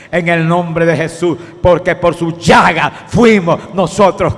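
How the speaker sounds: predicación fuerte y sostenida con gritos; predicación a pleno grito. Acted. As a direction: empujar a creer en el milagro. Predica a la audiencia con fuerza. (el predicador grita a quien lo escucha)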